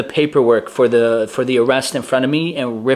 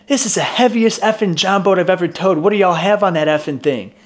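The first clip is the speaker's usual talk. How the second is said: annoyed cop; 7 semitones higher than usual. Retold or imitated. Cop struggles towing heavy boat.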